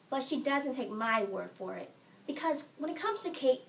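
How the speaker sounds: ordinary talk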